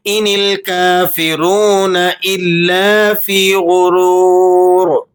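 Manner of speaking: measured, melodic tajweed recitation; Quran recitation. Acted. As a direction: recite the verse slowly and melodically. (تجوید کے ساتھ تلاوت)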